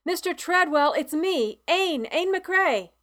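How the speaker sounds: high, loud call through the door. A narrator's reading. Ain calls through the door to wake Mister Treadwell, loud and high. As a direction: call out his name as you knock. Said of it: "knock hard on his door and call out".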